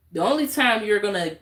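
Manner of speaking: ordinary delivery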